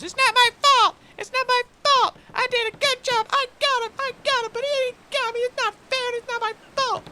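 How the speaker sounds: put-on very high, loud child's tantrum; fuming little half-orc. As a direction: squeal indignant protests like a small child. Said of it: "now fuming little half-orc child".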